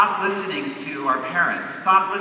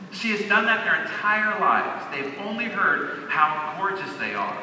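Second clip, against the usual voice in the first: ordinary talk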